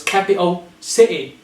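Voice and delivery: "capital city" as "capi'o si'ee"; both Ts caught in the throat of "capital city"; glottal T. Shown as a demonstration. Teacher combining the words, "capital city" with glottal stops. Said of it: "glottal T".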